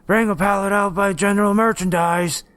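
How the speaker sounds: high-pitched, mocking rasp; raspy mimicry. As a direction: put on a raspy voice, mocking the manager. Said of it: "He made his voice raspy".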